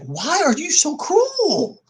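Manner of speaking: high, loud and slow outraged question; imagined outrage. Retold or imitated. Picturing himself confronting the shelter worker, loud and outraged. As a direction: let the disbelief and anger stretch out. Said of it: "I'd be like".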